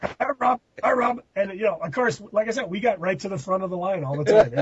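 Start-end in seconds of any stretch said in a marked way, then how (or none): 0.0-1.2 high-pitched echo of the gag; mock calling out. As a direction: call it in a goofy high voice. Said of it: "he would shout".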